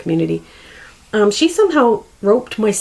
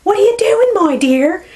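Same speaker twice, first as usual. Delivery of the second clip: put-on Newfoundland accent; 13 semitones higher, 7 dB louder, slower than usual. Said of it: "I wish I could do the accent".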